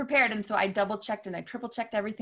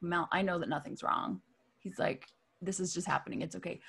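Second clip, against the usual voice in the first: lower, softer, unhurried reassurance; calm friend; 3 semitones lower, 5 dB quieter than usual. Retold or imitated. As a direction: settle her down gently. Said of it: "very calmly listen".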